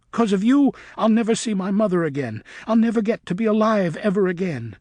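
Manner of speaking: snatch at what he won't use; fierce demand. A narrator's reading. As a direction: bat demanding his life in rage. Luna attacking Griffin.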